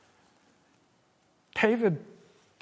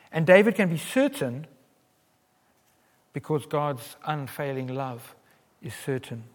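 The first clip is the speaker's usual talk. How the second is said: ordinary talk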